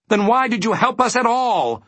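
high-pitched, indignant cry; angry shout. A narrator's reading. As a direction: demand it angrily, voice shooting up. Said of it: "shouted Milo angrily".

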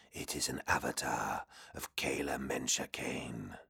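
low, breathy whispering demon. Acted. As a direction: hiss it like a hidden demon. Demon voice speaks.

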